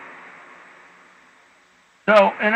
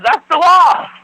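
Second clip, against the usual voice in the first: imitating them, much higher and louder; mocking insistence; 7 semitones higher, 7 dB louder, slower than usual. Mimicking people insisting it's the law, loud and mocking. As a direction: throw the quote out high and loud, mock-sure. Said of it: "I get people all the time says".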